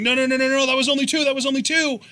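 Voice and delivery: imitating a ref, high and insistent; rapid-fire mock protest, pitched well up; urgent protest. Imagined referee waving off the three count, urgent protest.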